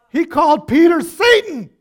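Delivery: high, loud shout; shouting to the crowd; preacher's shout. Real, not acted. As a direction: belt it out from the pulpit. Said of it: "preacher shouting point".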